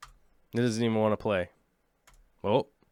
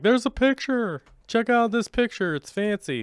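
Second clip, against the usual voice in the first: silly high voice; 10 semitones higher than usual. Pretend. Mock voice pretending to show photos.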